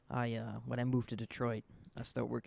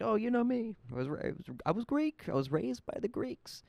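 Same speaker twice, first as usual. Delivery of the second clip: much higher, louder mock of a bragging boss; 10 semitones higher, 4 dB louder than usual. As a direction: squeak out his brag as a ridiculing impression. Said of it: "he's like".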